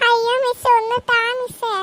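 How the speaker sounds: high whining plea for half a mark; whiny pleading child. Acted. Pupil begs the teacher for half a mark, high and whiny. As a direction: beg like a little girl close to tears. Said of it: "ഇവിടെ ഒന്ന് കരഞ്ഞിട്ട് കാര്യമില്ല".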